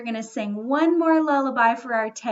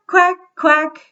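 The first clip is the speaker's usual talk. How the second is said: duck quacks; 2 semitones higher, 8 dB louder than usual. Pretend. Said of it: "I want to hear my ducks quacking".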